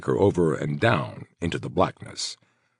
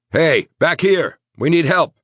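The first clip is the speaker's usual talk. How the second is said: yelled; 12 semitones higher, 6 dB louder than usual. Acted. Crow calling to the arriving police, loud yell. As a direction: call the police over as loud as you can. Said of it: "he yelled".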